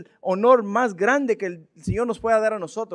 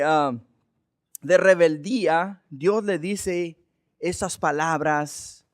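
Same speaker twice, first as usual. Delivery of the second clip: normal talking voice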